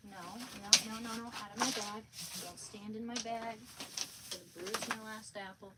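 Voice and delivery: ordinary talk, nothing marked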